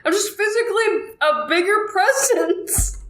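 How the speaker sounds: very high-pitched mock protest; hammed-up indignant comeback; insistent retort. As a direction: argue back in a squeaky re-enacted voice. Acted quarrel with brother-in-law.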